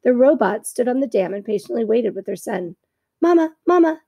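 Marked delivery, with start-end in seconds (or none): 3.2-4.0 high, loud peeping gosling cry; a baby bird begging for food; gosling calling. A narrator's reading. Hungry gosling calling his robot mother.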